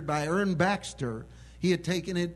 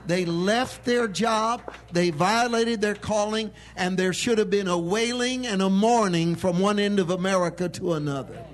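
full-force preaching; 5 dB louder than usual. Acted. Preacher rousing the congregation, full-force preaching. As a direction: thunder it from the pulpit.

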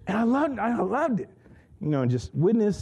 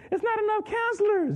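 complaining counselors. Retold or imitated.

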